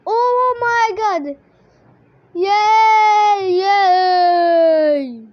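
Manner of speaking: loud, high, long-held cheering; real whooping celebration; jubilant cheer. Real, not acted. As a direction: cry out and stretch the yay. Celebrates in the game.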